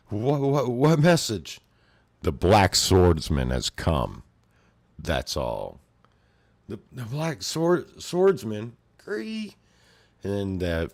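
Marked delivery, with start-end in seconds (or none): 0.1-1.6 high, loud stuttering fear; frightened thug stammering at the swordsman; panicked stammer. Retold or imitated. 2.2-5.8 slow, loud, low grim declaration; lightly voiced grim swordsman delivering his message. 6.7-9.6 high, rising terrified stammer; horrified squeal. Retold or imitated. Thug horrified on hearing name.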